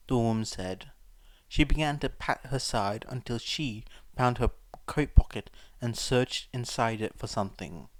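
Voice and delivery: ordinary delivery, nothing marked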